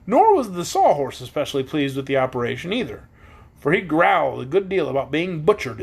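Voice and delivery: plain reading voice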